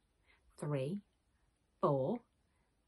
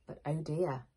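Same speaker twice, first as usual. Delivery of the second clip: plain reading voice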